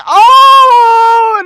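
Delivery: very high, loud crying shout. Retold or imitated. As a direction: let out a loud crying wail. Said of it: "now we're both crying".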